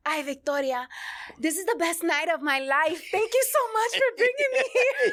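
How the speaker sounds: hammed-up grateful dad, laughing; imitating dad. Daughter imitating her dad thanking her, high and laughing. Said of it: "I remember you telling me".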